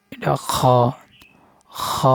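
খ breathed out with friction, unvoiced; whispered kha. Shown as a demonstration. Arabic teacher showing খ to learners, whispered. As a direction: rasp খ from the back of the throat, breathy. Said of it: "খর উপর এক নোক্তা".

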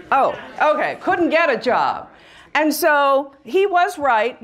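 normal talking voice

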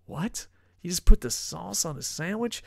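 hammed-up disbelief at the coworker's sauce idea; mock disbelief. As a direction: act shocked at the naive question. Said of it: "I was like".

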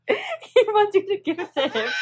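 laughing loudly through the words. Real, not acted. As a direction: laugh out loud while you say it. Laughing at the baby's reaching.

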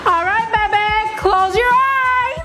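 high, singsong call to a partner; playful surprise announcement; excited calling out. Real, not acted. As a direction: call out sweetly, stretching the last words high. Calls partner to surprise dinner.

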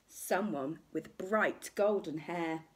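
ordinary delivery, nothing marked

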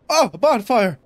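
high, loud cry of relief; excited discovery. Real, not acted. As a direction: exclaim it as a thrilled find. Finds the hoped-for bonfire.

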